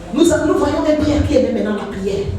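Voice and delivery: ordinary talk, nothing marked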